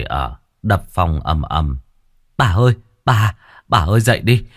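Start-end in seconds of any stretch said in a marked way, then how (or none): 2.4-4.4 raised, louder-edged cries of alarm; urgent calling. Acted. Servant waking the mistress with bad news, urgent calling. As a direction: call out urgently to rouse someone.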